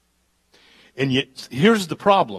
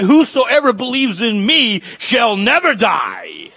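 slow, loud proclaimed verse; thundering scripture quote; shouted scripture; 11 semitones higher, 9 dB louder, slower than usual. Acted. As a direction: cry out the promise. Quoting scripture at climax.

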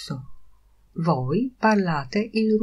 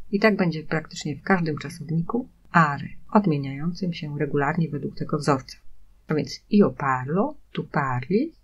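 normal talking voice

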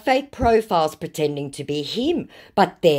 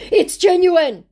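mock scammer insisting; 9 dB louder than usual.

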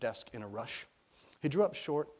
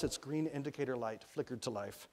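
plain reading voice

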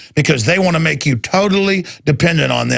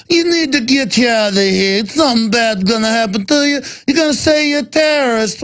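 high put-on mobster voice; security guard threatening the reporter in a mock mobster voice; mock Godfather gangster; 6 semitones higher than usual. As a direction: ham up a menacing movie gangster. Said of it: "literally like The Godfather".

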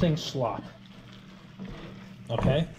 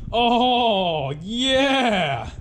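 excited; 7 semitones higher, 3 dB louder than usual. Real, not acted. Delighted at his mixed chowder.

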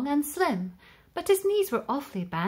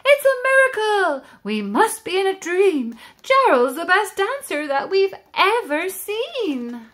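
higher, louder astonished cheering; amazed shouts; 9 semitones higher, 7 dB louder than usual. Acted. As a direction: cheer the giraffe in disbelief. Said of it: "They shouted".

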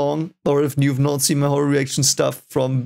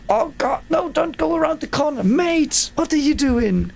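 panicked shout; 12 semitones higher than usual. Real, not acted. Panicked yelling at game character.

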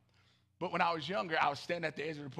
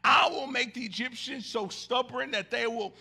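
louder, declaiming the verses; preached scripture reading; 5 semitones higher, 8 dB louder than usual. Acted. Pastor reading Exodus to the congregation, preaching. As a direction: proclaim the verses with force.

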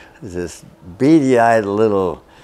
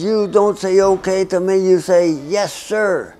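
scolding interrogator; 9 semitones higher than usual. Retold or imitated.

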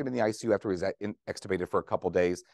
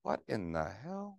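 softer, slow, stunned under the breath; stunned disbelief; 9 dB quieter, slower than usual. Retold or imitated. To colleagues, recalling the boy shutting down when mom appeared, stunned and soft. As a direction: let the shock drain the voice as the words come out slowly and softly. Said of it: "I was like".

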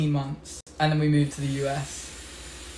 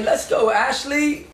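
pitched-up, drawn-out celebratory call; excited cheer; 7 semitones higher, slower than usual. Real, not acted. Streamer congratulating a viewer on one K, excited slow cheer. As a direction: stretch her name out in a cheer.

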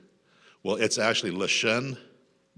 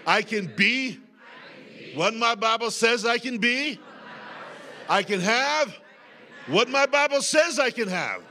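preaching at full force; 12 semitones higher, slower than usual. Acted. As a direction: proclaim it to the whole church.

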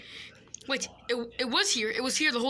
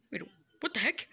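ordinary talk, nothing marked

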